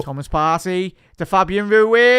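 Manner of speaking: building commentary as the ball flies in; excited commentary. Real, not acted. Live goal build-up.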